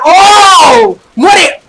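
high loud battle cry; excited shout. Real, not acted. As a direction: yell at the kill. Shooting an enemy from behind. (grito de batalla)

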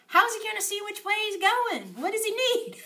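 high, loud playful exclamation; exaggerated teacherly bafflement; mock alarm. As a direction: puzzle aloud for the children, high and loud. Feigned puzzle over missing eye.

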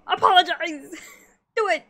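a playful scold broken by a laugh; laughing demand. Pretend. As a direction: scold through a giggle.

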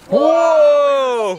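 loud high amazed whoa; surprised shout. Real, not acted. Dry ice hits the water.

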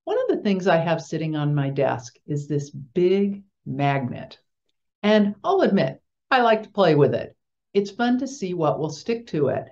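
ordinary delivery

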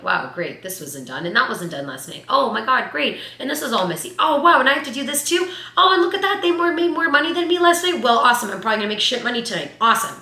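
sarcastic grumbling. Retold or imitated. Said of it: "come into work being like".

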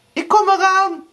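very high, loud answer; calling back. A narrator's reading. Erika answers Blauwbaard, calling out high. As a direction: call down in a high voice.

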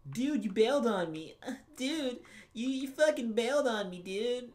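high, put-on voice whining "you bailed on me"; voicing a game character's accusation in a high put-on voice; character complaint.

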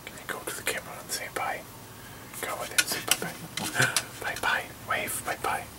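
soft whisper to the dog, fast. Real, not acted. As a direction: whisper it so only the dog hears.

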